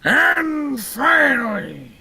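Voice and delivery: slow, high monster bellow; lumbering creature announcing the last question; Frankenstein's monster. Acted. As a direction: drag it out portentously.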